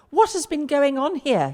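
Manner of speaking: father finding the broken idol, angry demand. A narrator's reading. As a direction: storm in demanding answers. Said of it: "he demanded".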